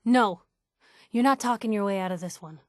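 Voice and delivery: ordinary delivery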